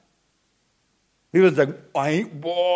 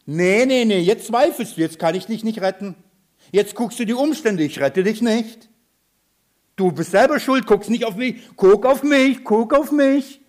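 raised, high-pitched reproach, growing louder; mock scolding; 5 semitones higher, 3 dB louder than usual. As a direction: act out an indignant refusal to help, insistent at the end. Spielt einen vorwurfsvoll scheltenden Jesus. (gespielt vorwurfsvolles Schimpfen, immer eindringlicher)

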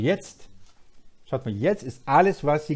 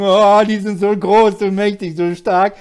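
awed fearful thought; 7 semitones higher, 11 dB louder than usual. Retold or imitated. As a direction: gasp at how huge the enemy is. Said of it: "die Israeliten denken".

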